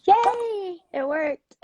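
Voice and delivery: high, loud squeal of joy; happy yay. Real, not acted. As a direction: cheer like it finally worked. Tech setup finally works on live.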